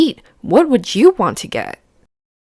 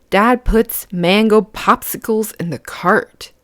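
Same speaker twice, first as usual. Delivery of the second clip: ordinary delivery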